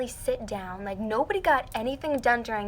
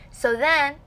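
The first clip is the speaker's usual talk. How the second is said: ordinary delivery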